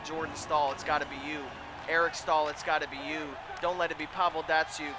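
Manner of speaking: usual speaking voice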